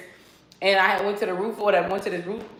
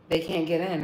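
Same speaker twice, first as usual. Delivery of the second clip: ordinary talk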